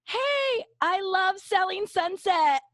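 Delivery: high, loud, drawn-out fan shout; gushing high-pitched holler; excited yell. Retold or imitated. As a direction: yell it to celebrities across the street. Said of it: "And I yell".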